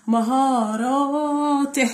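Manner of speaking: "مهاراته" very slowly, both "ا" vowels held long; drawn-out vowels. Shown as a demonstration. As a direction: stretch "مهاراته" to show its two madds. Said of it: "فيها مدين بالألف".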